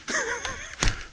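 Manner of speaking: high, soft giggle; quiet high-pitched laughter; helpless laugh. Real, not acted. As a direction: laugh helplessly at your own plight. Laughs at his own plight.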